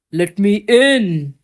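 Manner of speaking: slow, loud growl of a wolf. Acted. Hungry wolf growling at the stick house door, slow and loud. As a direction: growl it out slowly, big bad wolf. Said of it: "he growled".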